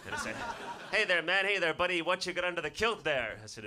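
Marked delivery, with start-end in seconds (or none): 0.9-3.4 loud, higher-pitched nosy lad; mock a cocky bloke's matey greeting; brash passer-by. Lad asking what's under his kilt, brash and loud. Men heckle him about kilt.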